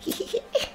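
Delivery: small high giggle; soft, squeaky little titter. Acted. Child giggles at own French.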